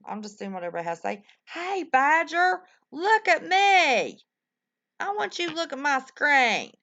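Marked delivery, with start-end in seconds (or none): 1.5-4.2 high, loud, slow call to Badger. Real, not acted. Calling out to chatter Badger. 5.0-6.7 insistent call. Real, not acted. Insisting Badger look.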